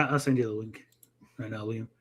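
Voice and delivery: ordinary delivery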